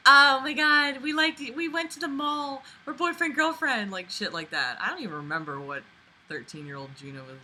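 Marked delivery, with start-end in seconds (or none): 0.1-3.9 put-on high giddy teen voice; mock teen girl. Mocking how young teens talk about dating, high giddy voice. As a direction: gush like a thirteen-year-old. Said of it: "others are like".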